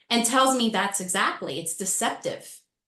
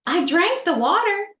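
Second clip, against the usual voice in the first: second take much higher and louder; panicked realization, pitched up and loud; alarmed cry; 9 semitones higher, 5 dB louder than usual. Retold or imitated. To herself after failing the test, a panicked louder cry.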